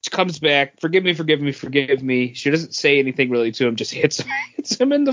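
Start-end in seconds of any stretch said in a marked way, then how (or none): none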